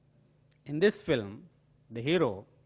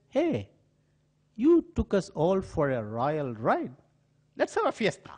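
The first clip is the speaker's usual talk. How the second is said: cheerful call; 5 semitones higher, 3 dB louder than usual. Retold or imitated. Colombians cheer the caught crook.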